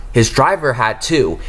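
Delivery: plain reading voice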